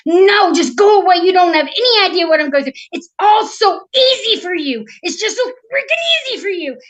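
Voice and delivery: high, loud outburst; furious older princess. Acted. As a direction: yell it in bitter, rising exasperation. Princess lashes out at sister.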